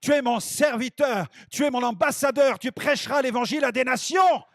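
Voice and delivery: commissioning loudly, building to a shout; voice of Jesus. Retold or imitated. Jesus calling his new servant to preach, loud and building. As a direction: proclaim it like a solemn calling from heaven. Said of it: "j'entends cette voix de Jésus qui dit".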